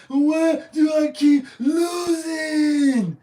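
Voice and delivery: wailing, drawn-out mock sob; imitating a crying Derek Carr wailing over losing. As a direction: ham up a crying player. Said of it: "he was like".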